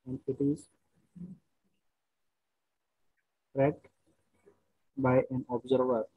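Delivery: very slow, word by word, repeating phrases; slow dictation. Shown as a demonstration. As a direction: dictate slowly so students can write. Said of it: "question लिखिए पहले यहाँ पे".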